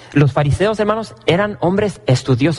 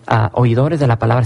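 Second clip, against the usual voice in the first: ordinary talk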